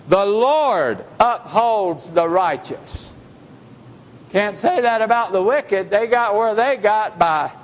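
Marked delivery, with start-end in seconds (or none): 0.1-3.1 slow solemn reading. Acted. Verse read, then repeated.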